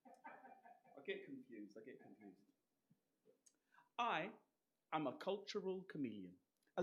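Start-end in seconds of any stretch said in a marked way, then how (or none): 0.1-2.4 low, far-off confused mumble; quiet mutter. Acted. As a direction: mumble it to yourself, fading.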